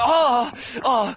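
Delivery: high, loud fumbling cries; hammed-up yelps of a klutz; mock flustered panic. Green Lantern tumbling to catch the robe, mock panic.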